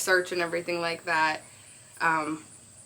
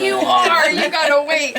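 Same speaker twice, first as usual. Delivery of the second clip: high, loud, laughing retort; excited teasing; 8 semitones higher, 11 dB louder, slower than usual. Retold or imitated. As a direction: tease him, half laughing. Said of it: "and I was like".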